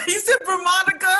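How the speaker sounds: high, loud, laughing disbelief; real laughter bursting through the words; cracking up. Real, not acted. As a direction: squeal it while cracking up. Cracking up at a slip.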